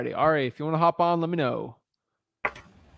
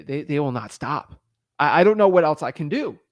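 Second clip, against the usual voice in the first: ordinary talk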